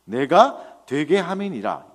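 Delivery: 내가 punched loud, then a slow reading; emphatic key verse. Shown as a demonstration. Preacher showing the core of verse five, 내가 stressed. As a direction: lean hard on 내가 and stretch the phrase. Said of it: "오 절의 핵심은 이겁니다".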